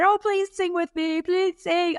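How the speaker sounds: normal talking voice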